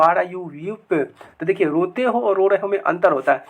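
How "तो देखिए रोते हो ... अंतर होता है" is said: normal talking voice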